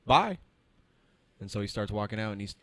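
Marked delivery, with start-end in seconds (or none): 0.0-0.4 higher, sarcastic brush-off; curt dismissal. Retold or imitated. Tech sarcastically dismissing the rude customer. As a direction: send him packing.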